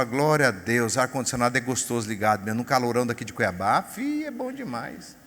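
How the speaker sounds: lightly voiced contented believer; mock grateful prayer. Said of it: "você fala". (oração de gratidão leve, mais grave e suave)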